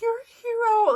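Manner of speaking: high, gushing admiration; overjoyed praise. Retold or imitated. Mom to son after duckling rescue, high gushing praise. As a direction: proclaim it high and proud.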